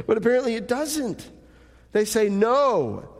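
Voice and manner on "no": stamp the "No." down hard; emphatic no. Retold or imitated. Pastor voicing the people's flat refusal, emphatic. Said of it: "There's an exclamation point there".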